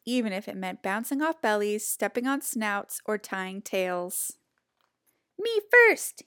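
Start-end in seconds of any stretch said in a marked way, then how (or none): 5.4-6.2 high, loud excited cry; cried out. A narrator's reading. Pushy pig crying out to be first. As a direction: shout it like a pushy piglet. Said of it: "he cried".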